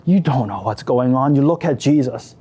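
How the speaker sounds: ordinary delivery, nothing marked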